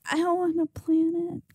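high, squeaky mock voice; mock whiny refusal. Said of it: "You're like".

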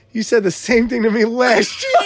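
laughing through a retort. Retold or imitated. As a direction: shoot it back while laughing. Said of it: "She goes".

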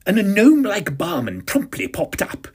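ordinary delivery, nothing marked

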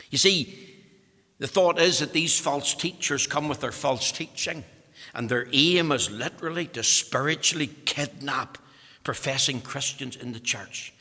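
ordinary talk, nothing marked

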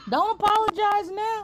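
high and loud, playfully outraged; mock outraged protest. Real, not acted. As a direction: cut off her apology in mock outrage.

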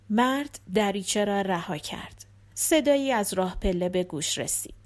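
usual speaking voice

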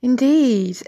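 normal talking voice

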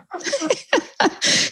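high delighted laugh; burst of laughter. Real, not acted. Laughing at neighbor's remark.

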